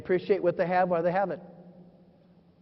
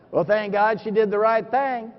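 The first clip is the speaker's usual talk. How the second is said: ordinary talk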